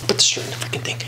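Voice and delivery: soft whispered self-instruction. Real, not acted. Soaked in the bath, whispering orders to himself. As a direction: murmur it under your breath. Muttering to himself while wet.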